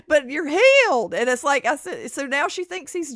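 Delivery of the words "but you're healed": mock wonder. Mocking the doctor's claim.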